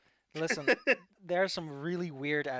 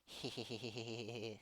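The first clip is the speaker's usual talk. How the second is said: cartoon laugh; 4 semitones lower, 8 dB quieter than usual. GM laughs after repeating.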